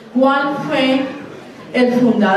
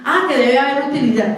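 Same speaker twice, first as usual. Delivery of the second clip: normal talking voice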